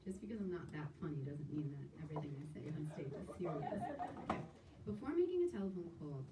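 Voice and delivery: normal talking voice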